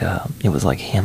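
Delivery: usual speaking voice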